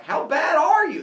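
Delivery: high, loud mock disbelief; hammed-up incredulous villagers; appalled question. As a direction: exclaim it aghast. Villagers react to music lesson.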